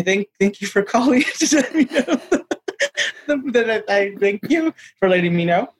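high, giggling thank you through laughter; laughing thanks. Retold or imitated. Director calls with the news, he thanks him laughing giddily.

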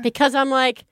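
usual speaking voice

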